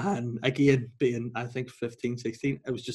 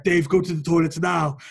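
loud mock-posh English order; prim posh English command, pushed loud; 3 semitones higher, 6 dB louder than usual. Teacher orders laughing pupil out, mock posh English. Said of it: "very, very posh".

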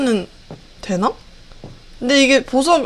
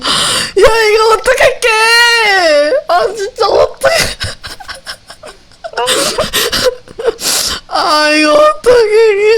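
high crying panic; being chased; 9 semitones higher than usual. Real, not acted. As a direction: whimper and cry out while fleeing.

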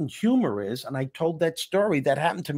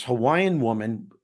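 normal talking voice

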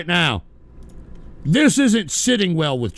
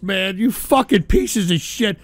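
normal talking voice

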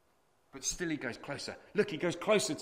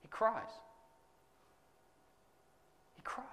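tender hush; 7 semitones higher, 10 dB quieter than usual. Acted. Preacher telling the church Jesus wept, hushed. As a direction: let the words fall quietly.

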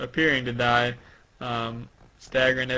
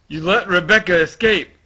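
loud, higher-pitched villain line; dramatic manga villain; acted accusation; 5 semitones higher, 11 dB louder than usual. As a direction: accuse them forcefully.

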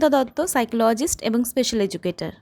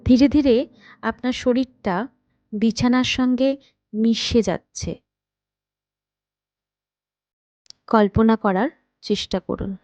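guided meditation. Acted. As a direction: guide them unhurriedly into rest, phrase by phrase. Leads a guided night meditation. (ধীর লয়ে, থেমে থেমে ধ্যান করানোর কণ্ঠ)

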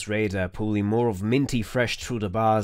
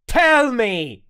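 loud, raised furious command; roared; 13 semitones higher, 6 dB louder than usual. Acted. Interrogator roars at the prisoner, furious. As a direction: bark it as a demand. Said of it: "I roared".

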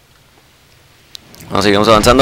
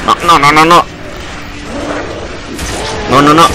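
panicked protest; 6 semitones higher than usual. Real, not acted.

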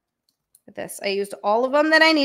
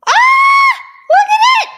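thrilled, high shout at the finished build; excited shout; 14 dB louder, much faster than usual. Real, not acted. Streamer shouting to chat about her finished Lego, excited shout. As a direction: yell it out, bursting with joy.

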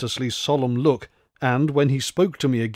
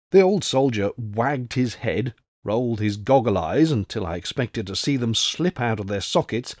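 ordinary delivery, nothing marked

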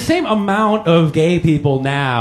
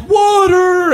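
high-pitched mock cry for water; imitated pleading shout; mock desperate plea; 12 semitones higher, 3 dB louder than usual. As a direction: cry out like a hammed-up desperate beggar. Mimicking desperate other countries begging.

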